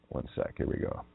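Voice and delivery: real whisper, breaking off mid-rant; whispered aside. Real, not acted. Pausing the rant to fix something, whispering. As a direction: murmur it off to the side.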